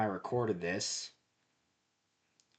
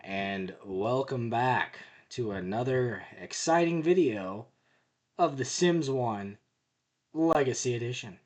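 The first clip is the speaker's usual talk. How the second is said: YouTuber intro; 3 semitones higher, 5 dB louder than usual. Acted. Channel intro to viewers.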